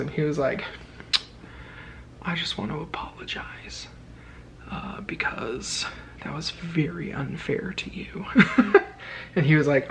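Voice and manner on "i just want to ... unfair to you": whispered apology; hushed, slow apology. Retold or imitated. Second janitor apologising quietly.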